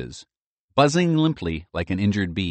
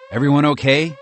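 urgent question; 7 semitones higher, 7 dB louder than usual. A narrator's reading. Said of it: "he asked urgently".

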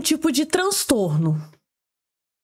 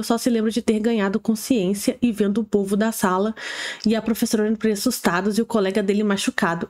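normal talking voice

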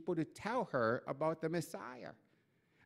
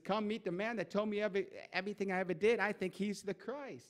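eager invitation; 6 semitones higher than usual. Retold or imitated. Woman excitedly calling townspeople.

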